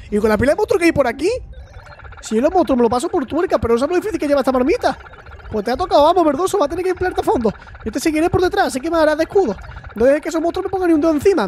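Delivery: high-pitched Andalusian robot voice. Acted. Robot companion hurrying to the volcano, high Andalusian accent. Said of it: "tiene acento andaluz el robot".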